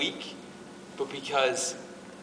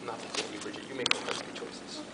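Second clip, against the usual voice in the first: quiet aside; 6 semitones lower, 10 dB quieter than usual. Real, not acted. Aside to Bridget.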